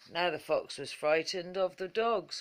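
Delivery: usual speaking voice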